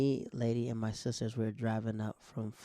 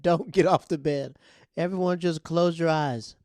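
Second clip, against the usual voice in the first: mom's order; 5 semitones higher, 9 dB louder than usual. Retold or imitated. Mom ordering kids to stay.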